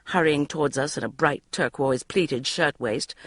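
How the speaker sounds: usual speaking voice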